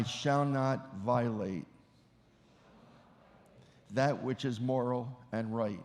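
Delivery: measured, solemn oath read in short phrases; formal oath recitation. Acted. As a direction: lead the room through a solemn vow. Leads the oath for repetition.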